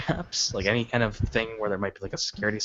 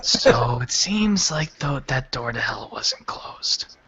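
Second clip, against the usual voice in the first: ominous whisper; 4 semitones higher, 4 dB louder, much slower than usual. Acted. Ominous realization about hell door.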